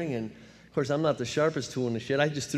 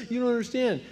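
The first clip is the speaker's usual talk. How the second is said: mock crying; 10 semitones higher than usual. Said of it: "now I start crying 'cause I'm afraid".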